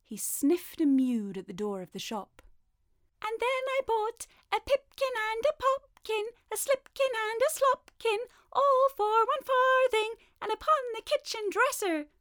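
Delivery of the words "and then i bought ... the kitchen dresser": high, loud squeaky taunt; saucy mouse voices; rude little mice. A narrator's reading. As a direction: tease cheekily as tiny mice. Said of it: "added the rude little mice".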